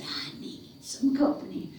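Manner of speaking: soft, whispery creature voice; hushed, breathy hairy-man voice; sly monster. Acted. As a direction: creep it out softly, like a monster.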